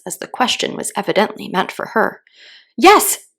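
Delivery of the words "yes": loud, high yes; shouted. A narrator's reading. Alice shouting her answer to the queen. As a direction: call it out boldly. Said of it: "shouted Alice".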